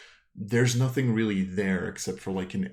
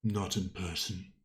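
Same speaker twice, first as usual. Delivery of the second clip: deep rumbling voice. Acted. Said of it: "you hear a deep rumbling voice".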